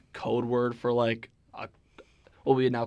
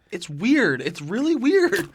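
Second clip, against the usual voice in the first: louder and higher, slowing on "really weird"; building emphasis; 7 semitones higher, 8 dB louder, slower than usual. Real, not acted. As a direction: push it harder each time. Teasing that he's acting weird.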